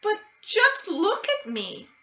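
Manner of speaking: put-on high, pleading little-girl voice; a girl begging her mother to look; child's voice. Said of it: "Camila answered".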